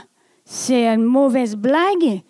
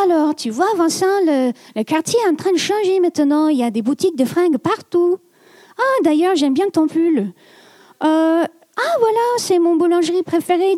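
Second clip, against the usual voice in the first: higher, quick, chatty Parisian French; 5 semitones higher than usual. Retold or imitated. To her friend Vincent in Montreal, eager Parisian French. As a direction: show off her shiny Parisian French, a bit higher and fast. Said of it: "c'est très parisien".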